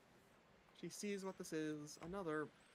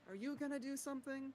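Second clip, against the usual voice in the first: high, even-pitched character voice; in-character questioning; 9 semitones higher than usual. Acted. Rakona taps the ball and asks it, high character voice. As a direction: ask the silent object in a high voice.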